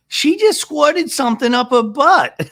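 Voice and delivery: high, loud, slow mock announcement; Gina. Recapper mimics a housewife breaking the news, loud high mockery. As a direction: blurt it high and loud, cracking up after. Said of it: "And Jean's like".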